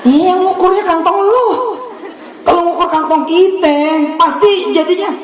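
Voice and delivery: high, laughing jab; mocking jab. Real, not acted. Preacher teasing the congregation, high laughing jab. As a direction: tease them with a high laugh. Preacher mocks small-minded request.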